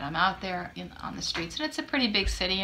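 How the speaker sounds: ordinary talk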